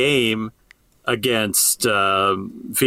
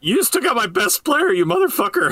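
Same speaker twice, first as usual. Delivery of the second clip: mock furious coach, pitched way up at talking volume; angry coach; 12 semitones higher than usual. Co-host voices the coach at the goalie, in high-pitched mock anger. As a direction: cuss out the other side in a high mock rage.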